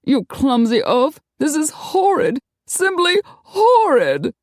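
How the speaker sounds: shrill, high, indignant noblewoman; haughty outraged lady. A narrator's reading. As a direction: rage like a spoiled aristocrat. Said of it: "vent her rage".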